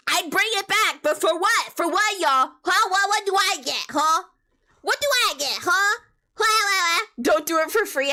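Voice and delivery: cheeky mock outrage at viewers; mock demanding. Real, not acted. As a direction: demand payment from chat in jest. Teasing chat for payment.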